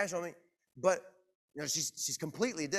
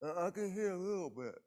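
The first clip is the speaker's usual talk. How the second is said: imitated deaf speaker; 3 semitones higher, 4 dB quieter than usual. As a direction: imitate a deaf person's blurred articulation. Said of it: "'cause she's deaf, and she said this".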